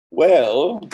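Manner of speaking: "Well" thrown out high and loud; emphatic well. Acted. Reader punching the refrain word to the audience.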